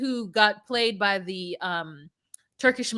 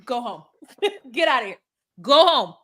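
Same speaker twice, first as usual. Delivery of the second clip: mock dismissal; faster than usual. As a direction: shoo her off, half laughing. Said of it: "When she".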